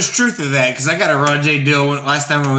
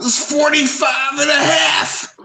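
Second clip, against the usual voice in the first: mock shout; 9 semitones higher, slower than usual. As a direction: stretch it out and shout it. Joking exaggeration, shouted slowly.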